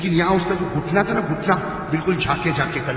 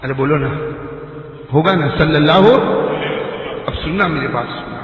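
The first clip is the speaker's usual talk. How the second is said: high, ringing sermon delivery; impassioned preaching; 7 semitones higher, 7 dB louder, slower than usual. Acted. Preacher telling the congregation of Aisha, high fervent preaching. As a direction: preach it out with rising fervour.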